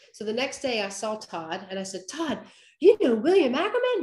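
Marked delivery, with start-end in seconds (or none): 2.1-4.0 excited. Retold or imitated. Excitedly asking friend about idol.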